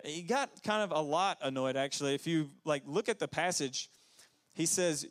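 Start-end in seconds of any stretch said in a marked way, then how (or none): none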